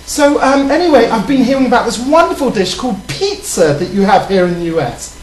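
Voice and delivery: prim, proper British lady voice; British accent, ladylike; put-on British accent. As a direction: play a posh English lady flirting. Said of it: "the accent is annoying the crap out of me".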